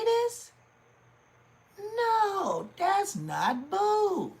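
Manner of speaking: very high, drawn-out incredulous squeal; high-pitched mock outrage; outraged disbelief. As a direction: squeal it slowly, appalled. Acted outraged disbelief at jokes.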